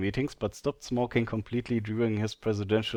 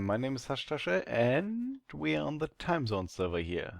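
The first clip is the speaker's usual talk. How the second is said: ordinary talk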